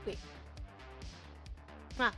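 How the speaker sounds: usual speaking voice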